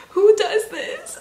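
surprised. Real, not acted. Overwhelmed by a friend's gift.

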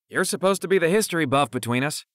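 high, loud teasing jab; quipping. A narrator's reading. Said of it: "Jordan quipped".

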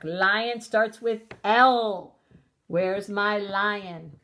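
very slow "Lion starts with L", then searching; drawn-out searching. Shown as a demonstration. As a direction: stretch it out while looking. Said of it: "searching slowly on screen".

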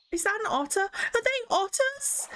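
high, surprised question; startled at spotting an otter; rising surprised squeak. Real, not acted. Spots an animal in game.

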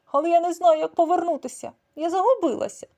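high, loud tearful cat; mock crying cat. A narrator's reading. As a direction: wail that she is lost. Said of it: "заплакала вона". (плаксивий високий голос кішки)

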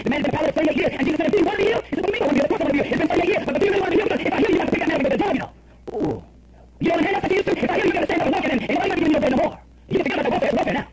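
high, animated sermon storytelling; full-force preaching. Acted. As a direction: act out the Bible exchange from the pulpit.

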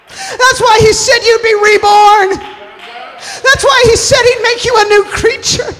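high-pitched, fervent preacher's cry; full-force preaching. Acted. Preacher testifying to being made a new creature, full-force preaching.

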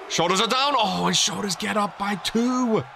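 high, stretched-out near-fall call; excited call. Real, not acted. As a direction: draw out the drama of the count. Near-fall call, then kickout.

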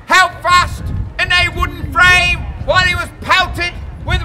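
booming public proclamation; town crier. Acted. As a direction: proclaim it to the whole square. Crier asking question.

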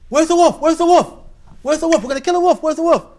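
high, loud, urgent shouting; play-acted excited crowd, repeated loud calls; alarmed villagers. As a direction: yell like a mob hunting the beast. Villagers rush in to help.

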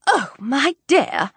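high, drawn-out wifely lament; distressed cry. A narrator's reading. Wife protesting at talk of the cousin, high lamenting cry. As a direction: cry it out slowly, high and plaintive. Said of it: "cried his wife".

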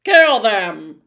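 loud fretful spider order. Acted. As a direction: order the kill in a fretful creature voice. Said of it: "clicked Aragog fretfully".

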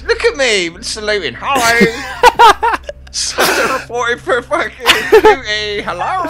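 high, loud laughing salute. Real, not acted. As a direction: giggle through the greeting.

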